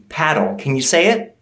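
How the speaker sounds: ordinary talk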